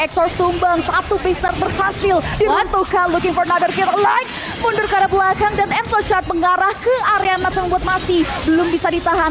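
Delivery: fighting game announcer. Real, not acted. Caster hypes a base push.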